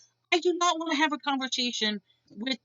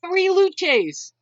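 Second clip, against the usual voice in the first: higher, louder imitation of a tickled joker; mock-delighted repeat, pitched up and punched out; mimicked amusement; 6 semitones higher, 6 dB louder than usual. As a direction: crow it like your own punchline. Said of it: "he starts cracking up laughing".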